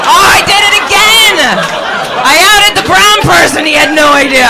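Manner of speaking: loud, high hammed-up dismay; mock horrified outburst. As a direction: cry out in pretend horror at your own blunder. Mock horror at outing audience member.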